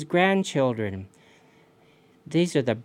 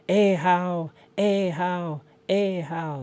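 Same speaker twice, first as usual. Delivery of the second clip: high, chanted leaping-fish sound; higher, evenly repeated splashy jump call; jumping salmon call; 4 semitones higher, much slower than usual. Acted. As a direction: sing out each leap of a salmon. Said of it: "That's the king salmon jumping".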